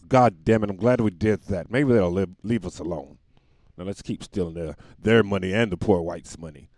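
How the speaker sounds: lightly voiced scheming majority.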